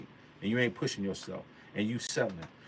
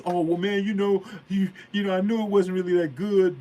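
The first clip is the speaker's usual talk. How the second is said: a lightly voiced whiny excuse; mock the guy who makes excuses; mock excuse-maker; 7 semitones higher, 5 dB louder than usual. Mocking an excuse-maker to his listeners, lightly whiny. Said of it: "never gonna make an excuse".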